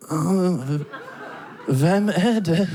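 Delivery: slow, small sleepy-sounding Danish voice; little voice. Acted. Danish family member woken in the cabin, little voice. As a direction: answer timidly, drawing the words out. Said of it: "this little voice go".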